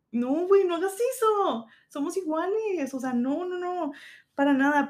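high, pleading protest; protesting modestly. Retold or imitated. Chef telling women not to bow to her, high flustered protest. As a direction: wave off the bowing, high and flustered. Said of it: "y yo decía de que".

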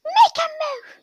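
crying tantrum, way up high; whining brat. Acted. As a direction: beg Daddy like a spoiled child. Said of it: "It's like".

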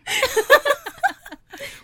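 high squealing laugh; helpless high-pitched giggle. Real, not acted. Laughing fit after intro.

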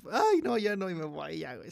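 playful scared protest; mock panic. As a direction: act out chickening out in a hurry. Acts out own scared retreat.

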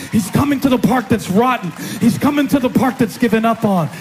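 high, driving sermon delivery; full-force preaching. Real, not acted. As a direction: preach it with building intensity, up into a shout.

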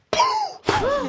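sharp high theatrical gasp; dramatic gasp. Acted. GM teasing the players, a big mock gasp. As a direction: gasp loudly for effect.

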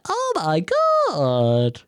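very slow, dopey gasp of wonder; mock voter gushing over the candidate, very slow; mock voter awe. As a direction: drag it out like a starstruck fool. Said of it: "some people are around the voting office going".

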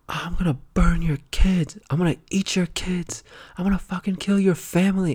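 high, strained mock rant without extra volume; ranting homeless man. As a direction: play a raving stranger hurling threats at passers-by. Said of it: "and he's like".

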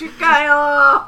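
loud, high, slow shout; stretched loud cry; loud drawn-out call. Acted. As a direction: call the name out long. Shouting a name.

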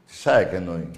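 usual speaking voice

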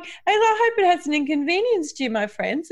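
high, dreamy, spaced-out voice; loopy and out of it. Retold or imitated. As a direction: sound totally out of it. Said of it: "totally loopy and out of it".

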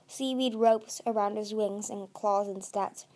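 ordinary delivery

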